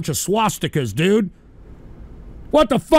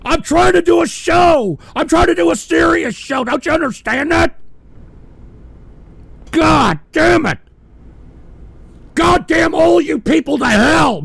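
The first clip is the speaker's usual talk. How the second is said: angry yelling; 5 semitones higher than usual. Real, not acted. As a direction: curse them out in fury. Furious rant at trolls.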